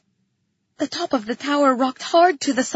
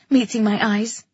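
ordinary delivery

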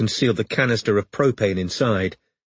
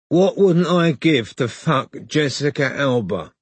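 slow, higher, dazed stoner voice; stoned man; 5 semitones higher than usual. A narrator's reading. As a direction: drag it out, high as a kite. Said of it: "asked a stoned male voice".

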